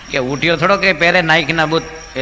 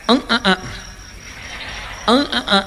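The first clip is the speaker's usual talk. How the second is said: much higher nasal grunts; nasal humming grunts; 7 semitones higher than usual. Retold or imitated. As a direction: grunt the name through the nose. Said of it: "ગૂંગણો". (નાકમાંથી ઊંચા અં અં અવાજ)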